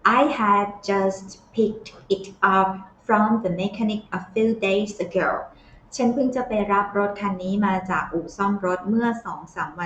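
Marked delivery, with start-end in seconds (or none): 0.0-5.3 very slow, word by word; slow model reading. Shown as a demonstration. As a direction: model the sentence slowly for copying. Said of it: "teacher models the clip line".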